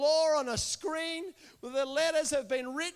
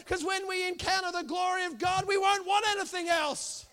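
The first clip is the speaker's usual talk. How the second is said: fiery sermon climax; preaching at full force; 3 semitones higher, 5 dB louder than usual. Acted. Preaching builds to a shout.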